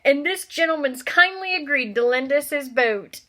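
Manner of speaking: high, loud, pompous announcing voice; Uncle Vernon. Acted. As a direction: present him like a showman. Vernon presenting the boatman.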